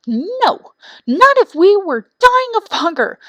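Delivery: high, loud stubborn chicks; defiant young birds. A narrator's reading. Young wrens refuse food from their parents, high and defiant.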